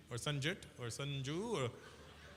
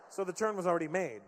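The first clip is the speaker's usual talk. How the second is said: ordinary delivery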